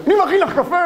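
high, loud mock shout; mock angry shout. Husband shouts at wife that he is making coffee, mock angry shout. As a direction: yell the kindness like a fight.

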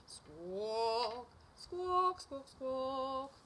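high, loud, stretched squawks; drawn-out bird squawk song; squawking magpie. Acted.

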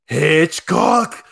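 loud mock battle cry; attack-name shout. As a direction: call out the move at full volume. Acts out called attack name.

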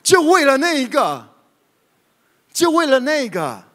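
high, loud half-laughing protest; playful high-pitched grumble, laughing; laughing mock complaint. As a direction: whine it like a joking gripe through laughter.

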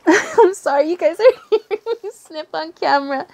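high, giggly apology; laughing apology. Real, not acted. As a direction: laugh through the words. Laughing at own sniffing.

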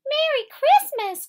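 high, loud excited squeak; small mouse cheering Merry Christmas; cried out. A narrator's reading. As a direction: burst out with a bright little cry. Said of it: "cried Mouse".

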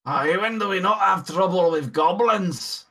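put-on gruff dwarf in a Scottish accent. Dwarf greets the orc in a Scottish accent.

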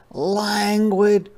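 high, slow, drawn-out sloth voice; unhurried sloth. Acted. Sloth voiced in read story.

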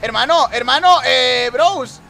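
high, loud yelling; shouted protest. Real, not acted. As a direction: yell at the other drivers. Protests to other drivers.

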